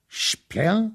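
high, loud incredulous cry; cry of disbelief. A narrator's reading. Prince crying out in disbelief at Kama's news. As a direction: cry out as if waking from a nightmare. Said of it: "zawołał".

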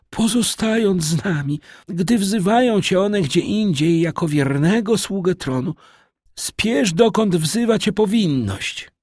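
put-on high, formal old nobleman urging duty; old marquis, stately. A narrator's reading. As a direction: send him off to his duties with lofty dignity. Margrabia voiced by narrator. (wysoki, dostojny głos starego margrabiego)

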